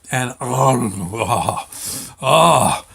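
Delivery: drawn-out moans of pleasure over the food; savouring moans. Real, not acted. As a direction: moan with delight at the taste. Savouring the first bite.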